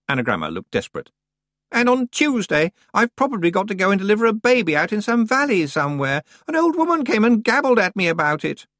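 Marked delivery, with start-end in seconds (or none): 1.7-8.7 put-on high, loud, complaining girl's voice; whiny young witch. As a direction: whine like a spoiled out-of-depth girl. Anagramma voice.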